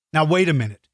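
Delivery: ordinary delivery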